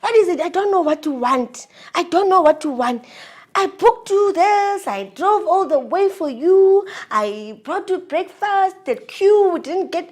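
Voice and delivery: loud, high-pitched angry imitation; angry outburst. Boyfriend listing what he did for her, angrily. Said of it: "Yo, he got angry".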